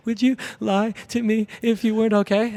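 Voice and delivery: high, tearful pleading question; imitated bawling. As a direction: mimic a crying wife needing reassurance. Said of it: "And so she's bawling".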